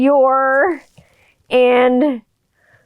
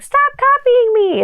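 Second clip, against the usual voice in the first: put-on very high, slow child squeal; cute kid voice; 3 dB louder than usual. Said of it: "When kids are like".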